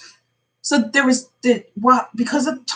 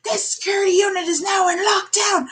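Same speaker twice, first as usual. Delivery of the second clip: higher, shouted lockdown order; retold guard's shout; 6 semitones higher, slower than usual. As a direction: bark the freeze order like a security guard. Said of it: "they go".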